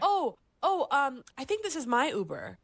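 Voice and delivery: sweet coquettish squeak. Retold or imitated. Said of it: "my voice up ten octaves".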